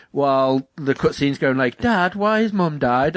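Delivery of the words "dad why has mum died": put-on high, slow plaintive kid voice; lightly imitated child, pitched up and slow; child in cutscene. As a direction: ask it like a sad little boy. Voices game child in sad cutscene.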